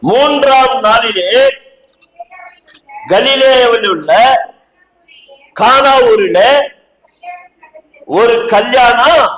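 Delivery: slow, drawn-out phrases with long pauses; deliberate verse reading. Real, not acted. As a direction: read scripture out slowly, phrase by phrase. (வசனத்தை நிறுத்தி நிதானமாக வாசித்தல்)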